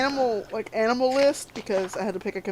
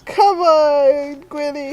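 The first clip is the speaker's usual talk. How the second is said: drawn-out, higher call to a pet; calling the cat; 4 semitones higher, 7 dB louder, much slower than usual. Acted. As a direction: coax the cat over from afar.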